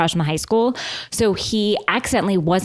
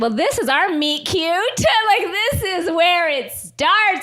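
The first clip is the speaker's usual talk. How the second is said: giddy fantasy; 9 semitones higher than usual. Retold or imitated. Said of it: "So I was like".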